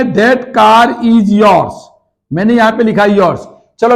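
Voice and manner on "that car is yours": very slow, word by word, as if writing on the board; slow dictation. Shown as a demonstration. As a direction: dictate it slowly to the class. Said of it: "चलो, मुझे लिखना है".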